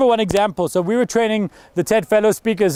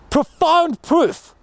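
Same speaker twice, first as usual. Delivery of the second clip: "profound proof" very slow and higher; dramatic stress; 6 semitones higher, much slower than usual. Shown as a demonstration. A coach showing the ideal TED opening, slow dramatic stress. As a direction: stretch out "profound proof" for big effect. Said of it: "if I was coaching that guy".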